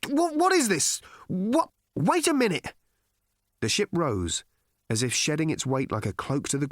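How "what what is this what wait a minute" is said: high, bewildered, urgent calls; calling out. A narrator's reading. Arthur watching the ship leave, calling frantically. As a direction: splutter and call after them in disbelief. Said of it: "he called".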